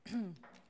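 short choking cough; clearing a choking throat. Real, not acted. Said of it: "I actually am choking".

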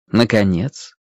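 plain reading voice

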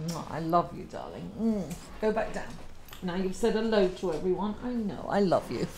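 soft, loving murmur to a dog; tender pet talk. Real, not acted. Owner sending her dog back down, tender and soft.

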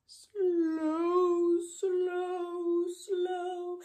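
high, long-drawn, singsong word; slow. Shown as a demonstration. Teacher showing children slow, stretched out high. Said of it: "fast and slow".

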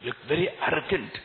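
usual speaking voice

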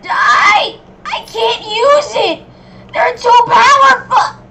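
very high, very loud, drawn-out yell; frantic shouting. Real, not acted. Gamer losing to too-powerful enemies, frantic high shouting. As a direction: shout in overwhelmed desperation, slow and high.

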